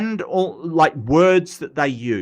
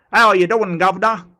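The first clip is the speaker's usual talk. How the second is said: loud, higher-pitched Cockney greeting; Cockney accent; 6 semitones higher, 6 dB louder, faster than usual. Shown as a demonstration. Streamer showing viewers the Michael Caine accent, loud Cockney. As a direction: put on a cheery-geezer Cockney hail. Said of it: "The Cockney accent's like the Michael Caine accent".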